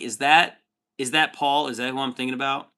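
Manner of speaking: ordinary delivery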